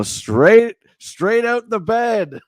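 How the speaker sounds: loud and high, slow; loud drawn-out call. Real, not acted. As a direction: call it out, stretching it. Calling brother in, just woken.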